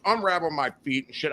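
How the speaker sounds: usual speaking voice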